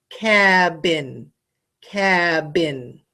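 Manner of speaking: "cabin" split slowly into CAB and BIN; "cabin" pulled apart with short vowels; syllable demo. Shown as a demonstration. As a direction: say "cabin" in two separate beats, cab, bin. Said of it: "do you notice how in this word".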